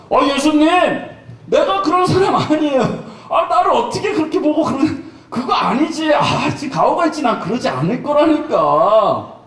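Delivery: Peter brushing it off, offended and cocky; protesting Peter. Retold or imitated. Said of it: "라고 베드로는 얘기를 했을".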